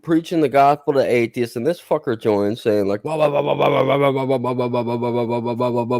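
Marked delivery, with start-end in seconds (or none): none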